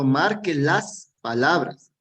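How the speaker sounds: "marque las palabras" slowed right down, leaning on "las"; stress on LAS. Shown as a demonstration. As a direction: stretch "las" and pause before "palabras". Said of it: "significa que no es solamente una".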